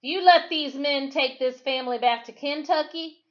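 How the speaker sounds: high, loud plea; called out. Acted. A freed man calling out a warning to the crowd, loud. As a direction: call out to the whole crowd. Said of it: "a lone voice called".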